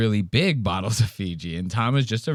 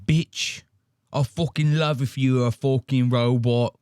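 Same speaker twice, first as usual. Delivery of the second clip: imitating Andrew Tate.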